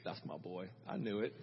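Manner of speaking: very low, hushed pride; Mary, proud mother. Retold or imitated. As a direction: imitate a mother's quiet aside about her son. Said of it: "I just wonder if Mary went".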